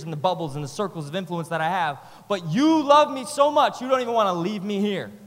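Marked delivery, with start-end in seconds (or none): 2.3-5.1 loud, high rallying sermon climb; full-force preaching. Acted. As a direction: drive the crowd up toward the call.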